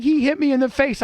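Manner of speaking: high, panicked retelling of her words; upset girlfriend on the phone. Retold or imitated. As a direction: imitate her frightened cry over the phone. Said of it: "She's like".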